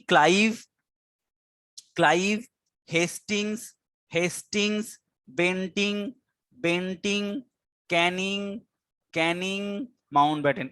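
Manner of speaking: each name set apart by a pause, lifted until the last one falls; slow, word by word. Shown as a demonstration. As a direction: dictate the list slowly, name by name. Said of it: "আস্তে আস্তে বলছি".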